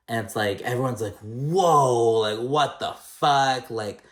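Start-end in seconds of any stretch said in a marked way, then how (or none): 1.2-3.7 loud, raised, drawn-out disbelief; big retold "whoa, what the fuck" shock; shocked group reaction. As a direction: react in stunned outrage, dragging it out. Acting out everyone's shocked reaction.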